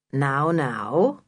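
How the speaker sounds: low, measured intervening voice; tall man's voice. A narrator's reading. Mister Barling interrupting the children's quarrel, low and measured. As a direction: step in low and unhurried.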